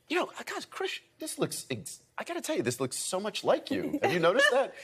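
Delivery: high-pitched imitation of an amazed onlooker; mock marvelling at a lookalike; Patrick Duffy. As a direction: act out earnest amazement, amused. Acts out Patrick marvelling at the likeness.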